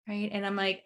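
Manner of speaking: ordinary talk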